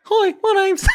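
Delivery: very high, squeaky put-on tradie; cartoonishly high tradesman introduction; mock tradie voice. Shown as a demonstration. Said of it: "do a tradie voice".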